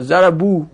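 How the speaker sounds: "ضربو" said zarabu, ending on a long u; damma before vav. Shown as a demonstration. Teacher sums up the rule, "ضربو" as zarabu with damma. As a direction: finish "ضربو" on a pure oo, zarabu. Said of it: "قبل واو ضمه میاد".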